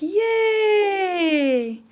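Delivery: long, loud, high cheer; super excited. Shown as a demonstration. Teacher drawing a happy mouth, excited cheer. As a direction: stretch out a jubilant whoop. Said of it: "We're super excited".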